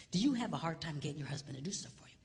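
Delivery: fast hushed whisper; whispered question. Retold or imitated. Pastor whispers quickly to a lady before service. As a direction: lean in and whisper it.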